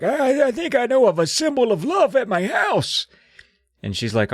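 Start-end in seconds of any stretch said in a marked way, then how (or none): 0.0-3.1 put-on high, strained old man voice. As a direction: croak it out like a frail old man.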